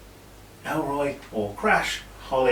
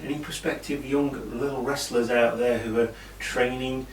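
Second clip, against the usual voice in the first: ordinary talk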